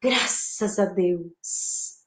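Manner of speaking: low, slow, soft gratitude; deeply grateful, hushed and slow; thankful. Shown as a demonstration. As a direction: breathe out thanks, drawn out and low. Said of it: "Posso agradecer".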